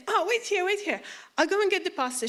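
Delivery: high, urgent and flustered; imitated breaking-news excitement; excited church lady. Voicing flustered lady fetching pastor.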